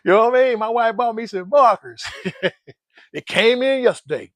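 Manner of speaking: ordinary talk, nothing marked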